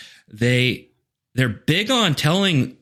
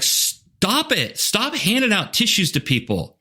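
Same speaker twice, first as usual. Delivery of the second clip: higher, exasperated plea to stop; an impatient voice in the head, pitched up; exasperated inner protest; 9 semitones higher, slower than usual. Retold or imitated. Coach's exasperated inner thought.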